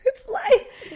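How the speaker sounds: high, giggling mock echo; mock her excuse through a laugh; laughing mimicry. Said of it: "Making excuses that she always does".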